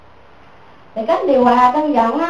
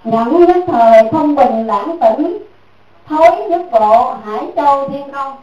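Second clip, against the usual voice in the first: reciting a maxim; slower than usual. Shown as a demonstration. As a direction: intone it like a scripture line. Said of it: "học cái câu này nè".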